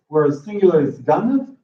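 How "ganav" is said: "ganav" stressed on GA, weak second vowel; first-syllable stress. Shown as a demonstration. Teacher giving the singular, "ganav" stressed on the first syllable. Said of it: "there is a shift in stress".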